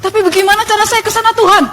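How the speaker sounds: fast, high urgent question; retold yelling. Asking God the way on the street, mock yell. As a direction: call it out high and hurried. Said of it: "Teriak-teriak di jalan gitu".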